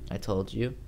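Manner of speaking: ordinary delivery, nothing marked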